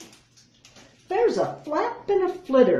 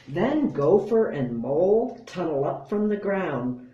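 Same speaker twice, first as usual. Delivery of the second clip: ordinary delivery, nothing marked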